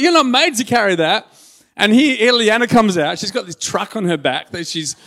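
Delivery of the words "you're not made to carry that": high, loud comic incredulity; playful, worked-up exclamation; mock protest. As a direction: protest it with exaggerated disbelief. Mock protest to laden child.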